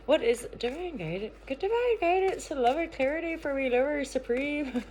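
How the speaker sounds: high-pitched, not-great Irish lilt; wobbly Irish accent. Pretend. Card reader who can't do accents asks for guidance, mock Irish accent. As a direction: put on a playful, badly faked Irish accent. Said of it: "Is that a bit of an Irish accent?".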